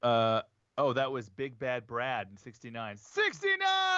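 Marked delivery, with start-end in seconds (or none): 3.0-4.0 high, slow drawn-out call. Real, not acted. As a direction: announce the name like a ring announcer. Calling out a player's name.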